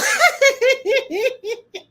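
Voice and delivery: big high laugh at own joke; delighted cackle; high burst of laughter. Real, not acted. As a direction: laugh out loud, high and long. Laughing after own bit.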